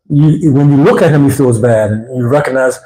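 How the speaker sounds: ordinary talk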